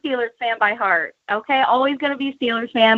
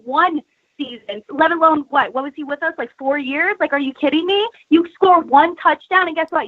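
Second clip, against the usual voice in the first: ordinary talk, nothing marked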